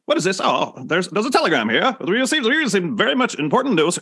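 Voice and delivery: a 1920s mid-Atlantic film clerk; mid-Atlantic accent. Retold or imitated. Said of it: "old-timey movie voice".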